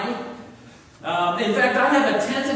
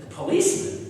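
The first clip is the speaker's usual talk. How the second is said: startled; 11 semitones higher than usual. Retold or imitated. Boss startled on phone.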